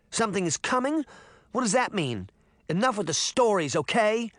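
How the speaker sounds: put-on high, exasperated yell of a fed-up girl; angry yell. A narrator's reading. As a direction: yell at the old woman, done with her stories. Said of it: "Sabrina yelled".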